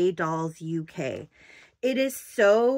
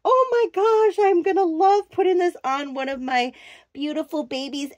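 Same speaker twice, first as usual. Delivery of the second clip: retold excitement; 6 semitones higher than usual. Remembering finding the outfit, high delighted squeal. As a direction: squeal it like the first unboxing. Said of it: "I was like".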